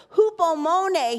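hupomone proclaimed high, loud and slow; preacher's slow, loud hupomone; emphatic word. Acted. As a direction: ring out hupomone from the pulpit. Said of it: "emphatic Greek word".

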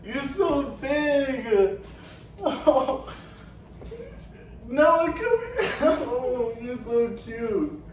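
high voice with real laughter. Real, not acted. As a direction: coo slowly, laughing with delight. Hugging and cooing over young son.

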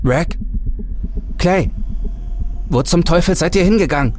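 high, fast frightened calls; panicked calling. Acted. Markus wakes alone in the dark and calls for his friends, high and scared.

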